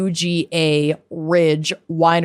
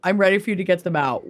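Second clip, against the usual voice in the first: rushed repeat; much faster than usual. Real, not acted. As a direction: rattle it off quickly under breath. Eager host repeats line.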